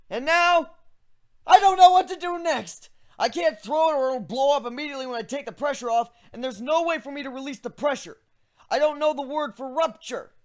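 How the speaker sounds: realising too late there's no plan; griffin voice, alarmed. Acted. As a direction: griffin voice going loud and panicky. Griffin admits he is stuck.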